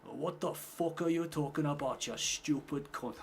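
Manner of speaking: slow, high-pitched mock Geordie outrage; Geordie accent. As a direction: stretch it out in an imitated Geordie accent. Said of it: "The Geordie accent's still my favourite".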